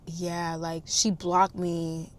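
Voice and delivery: low, soft and halting; subdued consoling. Retold or imitated. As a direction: imitate a man quietly offering feeble comfort. Retells his sheepish consolation.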